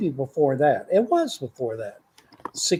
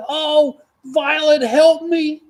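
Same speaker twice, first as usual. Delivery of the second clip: very high, louder mock plea while falling; Nana's cry for help; 12 semitones higher, 8 dB louder, much slower than usual. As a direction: squeal for help like a falling grandma. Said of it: "and she said".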